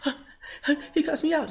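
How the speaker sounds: high, laughing, flustered voice; flustered cashier. Retold or imitated. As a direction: scramble to please, laughing. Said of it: "you know what she did".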